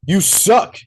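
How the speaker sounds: high, loud jeering taunt; mocking heckle. Teen jeering at the killer as if at a haunted house, loud and mocking. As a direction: heckle the bad actors. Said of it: "you just hear one of them go".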